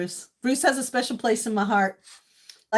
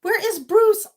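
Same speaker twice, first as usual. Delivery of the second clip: mock calling out; 9 semitones higher, 3 dB louder than usual. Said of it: "I was just saying this morning".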